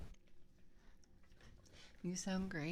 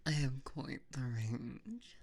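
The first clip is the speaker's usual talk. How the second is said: low, very slow mommy voice; 8 semitones lower, much slower than usual. Shown as a demonstration. Streamer shows off vocal range to chat, low mommy voice. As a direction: drop low and stretch it out. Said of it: "mommy voice".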